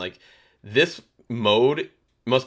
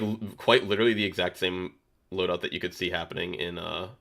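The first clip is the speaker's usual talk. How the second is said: ordinary talk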